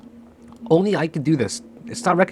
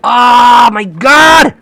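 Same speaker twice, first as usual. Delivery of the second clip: very slow, high, loud wail; anguished shout; 6 semitones higher, 10 dB louder, much slower than usual. Real, not acted. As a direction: cry out as the trade slips away. Price drops away.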